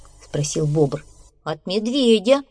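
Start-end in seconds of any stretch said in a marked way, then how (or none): 1.4-2.4 nervous, raised-pitch answer; trembling voice. Acted. Badger explaining to beaver, voice trembling. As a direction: answer with a touch of fear. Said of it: "дрожащим голосом".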